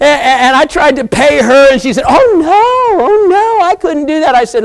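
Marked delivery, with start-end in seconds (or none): 2.1-4.3 much higher sing-song lady voice; high, sweet lady declining payment; sweet old lady. Retold or imitated. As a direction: squeak it like a polite little lady refusing money. Lady refuses his payment.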